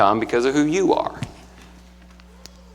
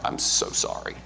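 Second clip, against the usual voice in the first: a near-silent, breathless whisper; hushed sorry to his mother; whispered apology; 12 dB quieter than usual. Retold or imitated. Tearful apology call to mother.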